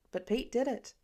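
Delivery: ordinary delivery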